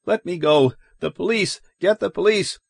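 high, desperate demand; panicked, high-pitched urgency; frantic cry. A narrator's reading. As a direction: cry for help while struggling. Lois struggling free, calling for police.

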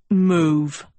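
one-word angry order; stern, restrained command; curt command. A narrator's reading. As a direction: order her aside firmly. Angry prince orders Sophie aside.

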